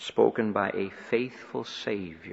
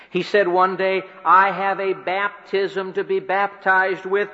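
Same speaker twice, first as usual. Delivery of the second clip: preaching at full force; 7 semitones higher, 7 dB louder than usual. Acted. Preacher to the congregation, building to the hour of the cross, forceful and climbing. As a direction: raise it higher and louder, pressing home each line.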